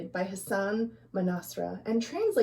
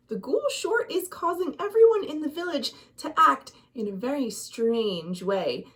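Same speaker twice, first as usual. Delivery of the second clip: ordinary delivery, nothing marked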